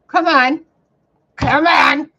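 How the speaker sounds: loud, high wail of come on; loud cry. Real, not acted. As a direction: cry out in frustration. Frustrated outcry.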